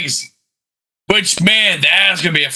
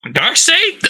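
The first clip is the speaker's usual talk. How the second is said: surprised; 12 semitones higher than usual. Real, not acted. Incredulous question.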